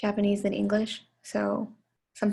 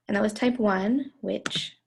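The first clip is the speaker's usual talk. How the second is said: ordinary delivery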